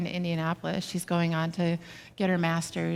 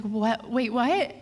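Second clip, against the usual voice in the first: alarmed, higher-pitched double take; startled what; 6 semitones higher, slower than usual. Retold or imitated. Mother hears daughter cry, alarmed and surprised. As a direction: catch yourself in sudden worry.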